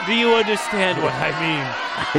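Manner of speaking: insistent shout. Acted. Loud insistent question.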